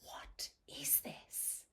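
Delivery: hushed bewildered whisper; soft stunned whisper; whispered quote. Retold or imitated. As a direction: breathe it out, baffled. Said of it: "and you're like".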